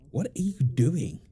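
ordinary delivery

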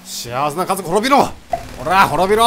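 loud, hammed-up battle cry; mock villain shout. Gamer attacking the happy family in game, mock-villain shout. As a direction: roar a playful curse at them.

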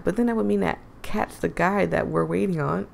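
ordinary talk

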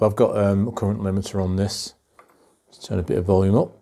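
ordinary talk

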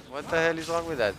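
normal talking voice

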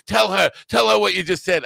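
loud, high-pitched frantic urging; imitated shock, voice shooting up; retold stunned insistence. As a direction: act out an astonished man demanding a witness. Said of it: "his jaw was at the bottom".

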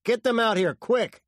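high, loud, hurried-sounding command; urgent order. A narrator's reading. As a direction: shout for help to be sent.

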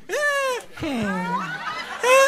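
high-pitched squealed "eh"; exaggerated high interjections; mock guest noises. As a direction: imitate a wedding guest's grunts. Mimics jealous wedding guest's reaction.

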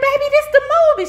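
high, loud and fast; exasperated. Retold or imitated. Speaker telling the wife it's only a movie, high exasperated. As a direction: blurt it out in disbelief. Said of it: "I said".

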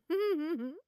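short high gloating laugh; gleeful laugh. Acted. Scientist gloating at the scared thief, high gleeful laugh. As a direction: relish the victim's fear in a laugh. Gloating over victim's fear.

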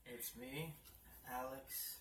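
ordinary talk

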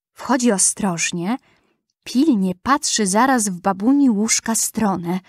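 usual speaking voice

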